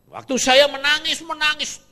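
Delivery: high, loud wailing preaching; loud anguished cry. Real, not acted. Preacher telling the congregation how he wept, loudly. Describing his weeping to congregation.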